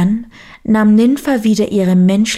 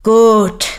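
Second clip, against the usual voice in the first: loud, weighty bear's reply; 4 dB louder than usual. A narrator's reading. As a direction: grant it firmly, as a big bear. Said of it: "sagte der Bär".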